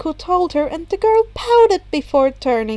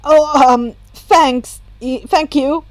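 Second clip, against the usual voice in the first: awkward thanks; 6 dB louder, slower than usual. A narrator's reading. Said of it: "Izuku smiled awkwardly".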